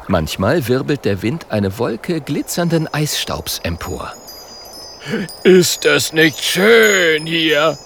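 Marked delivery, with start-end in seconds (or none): 5.4-7.8 slow, high, loud cry of delight; rejoicing. Acted. Polar bear showing his friends the ice, a slow joyful cry. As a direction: let the joy ring out. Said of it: "jauchzt Björn".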